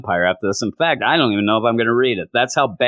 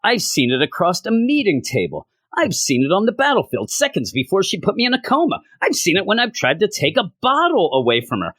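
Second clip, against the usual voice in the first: building laundry list; 10 semitones higher than usual. Acted. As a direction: pitch climbing higher and higher, faster at the end. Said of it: "and he says".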